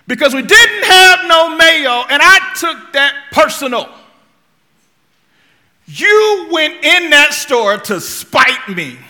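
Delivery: loud, high, furious rant about the mayo; re-enacted yelling. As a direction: yell it like a hangry husband who feels betrayed. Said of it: "but I was yelling".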